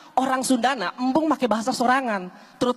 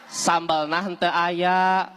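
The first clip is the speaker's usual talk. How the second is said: slow repeat; 4 semitones lower, much slower than usual. Acted. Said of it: "Coba ulangi sakali deui".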